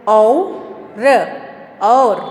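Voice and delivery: औ and र said apart, then joined as और; loud, slow sounding-out of और; syllable-by-syllable demo. Shown as a demonstration. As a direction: spell out औ, र, then blend into और. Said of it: "यहाँ देखिए".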